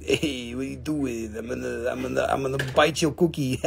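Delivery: lightly voiced muppet grouch, low and soft; a hint of a freaked-out puppet; Oscar the Grouch. Seller voices a funny-faced stone, light muppet grouch. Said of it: "this one's like Oscar the Grouch".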